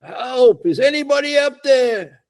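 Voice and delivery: high, loud panicked cries of help; hanging from a rock, calling up for help, high and loud; retold shout for help. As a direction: yell up the cliff, dangling. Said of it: "And he shouts".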